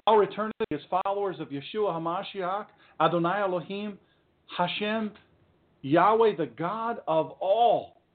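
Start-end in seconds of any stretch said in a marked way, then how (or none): none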